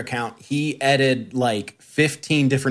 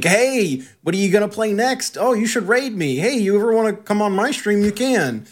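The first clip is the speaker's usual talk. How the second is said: higher, bright pestering voice; mock needy fan; 7 semitones higher than usual. Host imitates the fan pestering them every day, higher. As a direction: keep nagging like an eager puppy. Imitating his daily messages.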